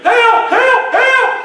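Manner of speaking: high, loud acted cries for help; screaming for help. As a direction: scream out as the panicking master. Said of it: "he started screaming".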